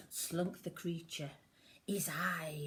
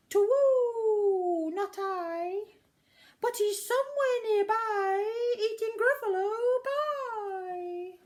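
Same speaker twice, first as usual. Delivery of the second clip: put-on a haughty owl hooting at the child; high wavering owl voice; 11 dB louder than usual.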